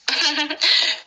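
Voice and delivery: bright rising village laugh; hearty laugh. Acted. Jethani laughs on phone. (खुली, ऊँची हँसी)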